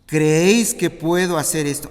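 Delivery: loud, ringing question to the blind men; proclaimed question; emphatic question. Acted. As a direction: put the question to them boldly. Preacher voicing Jesus' question.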